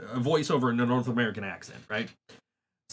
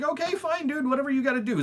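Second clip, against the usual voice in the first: high, sing-song give-up; mock high resigned shrug; fed-up resignation; 11 semitones higher, faster than usual. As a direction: toss it off way up high, done with him. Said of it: "So I'm like".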